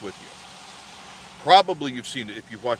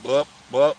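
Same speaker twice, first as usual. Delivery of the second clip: mock licking noise; 6 dB louder than usual. Imitating licking like a dog.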